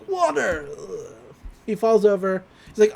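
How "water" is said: mock gasping. Dying merman gasps to his new friends, mock gasping. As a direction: gasp it out as if running out of breath. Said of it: "he starts gasping".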